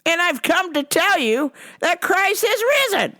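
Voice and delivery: mock zealot lecturing a cashier; preachy Rome guy. Man repeats his line.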